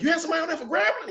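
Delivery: high rapid excited family question; excited relatives. Retold or imitated. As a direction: squeal it fast like a thrilled relative. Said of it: "They're like".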